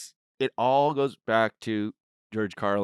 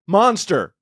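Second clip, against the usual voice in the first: eager loud explaining; 6 semitones higher, 7 dB louder, slower than usual. Retold or imitated. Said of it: "And he's like".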